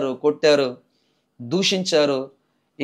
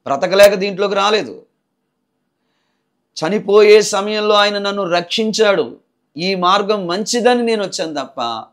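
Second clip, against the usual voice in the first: forceful preaching; 7 semitones higher, 8 dB louder than usual. Acted. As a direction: preach it out to the congregation. Testimony preached at full force.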